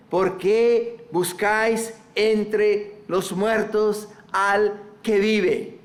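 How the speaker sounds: drawn out slow, each word weighted; slow solemn angel's question. Acted. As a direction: let the angels' question sink in slowly. Slow emphatic reading of angels.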